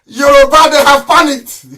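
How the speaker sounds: exultant yell; gloating shout. Real, not acted. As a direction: crow over them. Gloating at cut Facebook.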